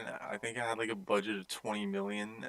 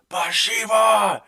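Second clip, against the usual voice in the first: high, drawn-out shout; full-force acted shout; investigator's yell; 11 semitones higher, 15 dB louder, slower than usual. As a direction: bellow the name as a re-enacted movie cry. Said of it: "ghost investigator was like".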